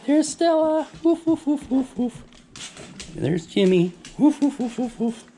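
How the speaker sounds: high mock woof woof; playful barking. Owner barking back at her dogs, high.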